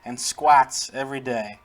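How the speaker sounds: loud, emphatic announcer read; mock-epic narration with punched words; game entry read-aloud. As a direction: proclaim the creature's training feats.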